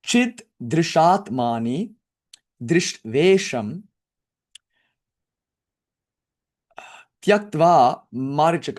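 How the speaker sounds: measured scriptural recitation; Sanskrit verse recitation. Shown as a demonstration. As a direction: recite the verse with long pauses between phrases. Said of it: "it says, rather".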